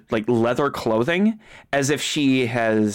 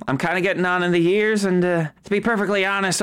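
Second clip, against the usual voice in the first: hurried; 2 semitones higher, faster than usual. Acted. Marjorie rushes explanation.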